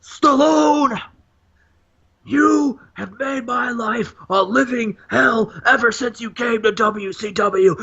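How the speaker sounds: hammed-up wrestler threatening his rival; Hulk Hogan promo. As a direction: cut a menacing heel promo. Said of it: "Hulk Hogan grabs the microphone and says".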